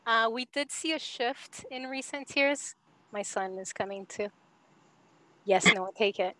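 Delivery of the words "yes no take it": normal talking voice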